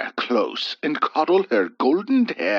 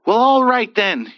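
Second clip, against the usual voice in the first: louder, higher sudden blurt; irate blurt; 10 semitones higher, 6 dB louder than usual. A narrator's reading. Rejected young man blurting in anger, louder. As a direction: snap it out irritated. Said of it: "he blurted".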